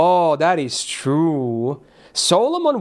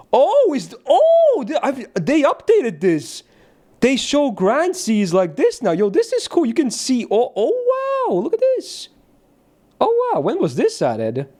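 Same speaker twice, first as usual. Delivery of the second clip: real excitement at a surprise update; excited discovery; 10 semitones higher than usual. Real, not acted. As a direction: gush over a cool new feature. Amazed by a surprise game update.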